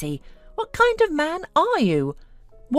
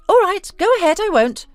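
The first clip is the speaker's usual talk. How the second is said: high, loud mock indulgence; exaggerated, scornful giving-in; 12 semitones higher, 5 dB louder than usual. A narrator's reading. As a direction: humour him scornfully, pitched way up. Wife mockingly humours her husband.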